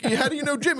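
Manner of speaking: high, surprised how-do-you-know; surprised question. Retold or imitated. Host answering neighbor who knows Jim, high surprised question. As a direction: act delighted at the coincidence. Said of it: "And I'm like".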